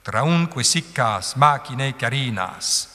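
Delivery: "traunque siccas machinae carinas" slow, long syllables held; scanned iambic line. Shown as a demonstration. Teacher gives the whole second verse, slow metrical recitation. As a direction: recite foot by foot, last syllable left alone. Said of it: "Alors ça donne".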